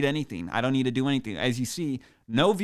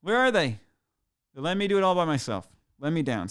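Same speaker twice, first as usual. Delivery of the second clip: mock outrage; 5 semitones higher, 4 dB louder than usual. Complaining he was left alone.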